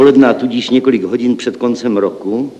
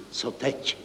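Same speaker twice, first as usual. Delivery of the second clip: hushed, anxious whisper; 15 dB quieter than usual. A narrator's reading. Deputy accountant to the group, a worried whisper. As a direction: breathe the question out in fear. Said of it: "zašeptal zástupce hlavního účetního".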